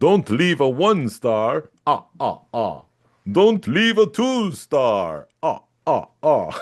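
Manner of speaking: slow, high Count counting voice. Acted. Host counting review stars as the Count from Sesame Street, slow and hammy. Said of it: "I could do the count from Sesame Street".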